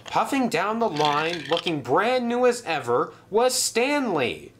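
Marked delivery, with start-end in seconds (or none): none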